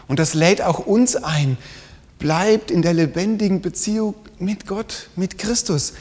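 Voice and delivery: ordinary talk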